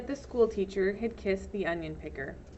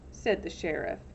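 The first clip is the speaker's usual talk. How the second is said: usual speaking voice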